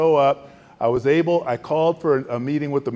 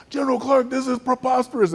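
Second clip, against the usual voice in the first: much higher, indignant outburst; outraged yell; 12 semitones higher than usual. Retold or imitated. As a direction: explode at the insult. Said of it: "he starts yelling at me".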